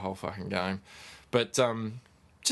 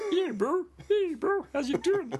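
put-on very high puppet voice, Kiwi accent; squeaky Bert with a Kiwi accent; slower than usual. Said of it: "Bert from Sesame Street like a Kiwi".

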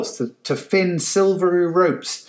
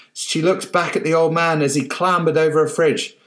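usual speaking voice

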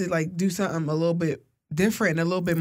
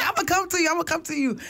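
eager; 8 semitones higher, faster than usual. Retold or imitated. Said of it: "everybody was like".

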